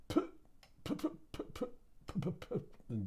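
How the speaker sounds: soft unvoiced putt-putt of a dying engine; whispered sputter. Trader mimicking the stock fizzling out, whispered engine noise. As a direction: breathe out the put-puts as the engine quits. Imitating a sputtering car again.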